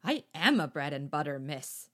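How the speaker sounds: rueful echo. Acted. Sylvette repeats the insult.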